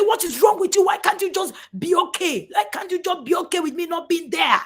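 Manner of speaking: acted angry yell, high and loud. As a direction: yell back at your parent, exasperated. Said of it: "your children will just yell at you and say".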